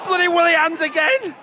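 loud, high heckle; real fed-up fan outburst; exasperated shout. Real, not acted. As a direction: yell your frustration at the keeper. Shouting about the goalkeeper.

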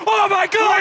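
high, loud cry of joy; ecstatic shout. Real, not acted. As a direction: shout at the goal. Another goal.

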